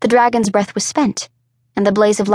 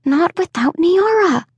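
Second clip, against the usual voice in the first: higher, slow, words pushed through clenched teeth; 8 semitones higher, slower than usual. A narrator's reading. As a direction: hold back fury, teeth clenched. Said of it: "through clenched teeth".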